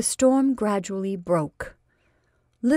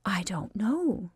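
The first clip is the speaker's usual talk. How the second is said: slow, soft stalling whisper; hesitant whisper; 5 dB quieter, slower than usual. Acted. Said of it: "she stalled".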